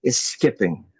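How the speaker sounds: rhythmic, emphatic poetry recitation; spoken word poem. Acted. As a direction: perform it like a slam poem.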